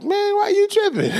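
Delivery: high-pitched, disbelieving squeal; incredulous retort. Retold or imitated. Preacher acting out his reply to grumblers, incredulous and high. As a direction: shoot up high in mock disbelief.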